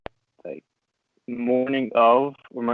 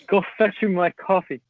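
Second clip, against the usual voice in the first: mock McGregor order; 7 semitones higher than usual. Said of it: "quoting McGregor".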